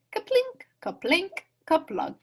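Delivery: high plinking sound words; ping the berries into the pail; berry plinks. A narrator's reading.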